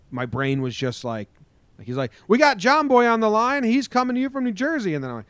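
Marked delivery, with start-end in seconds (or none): 2.3-4.9 high, loud on-air announcer; radio host impression. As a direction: introduce a caller in a hyped radio voice. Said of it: "when you get on that live radio show".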